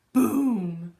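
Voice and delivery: very loud, booming sound word; explosive boom. A narrator's reading. Contraption explodes in the kitchen, very loud boom. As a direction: burst it out like a blast.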